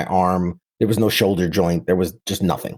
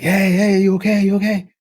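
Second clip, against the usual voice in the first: put-on thick Mexican accent; 10 semitones higher, 4 dB louder than usual. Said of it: "very thick accent".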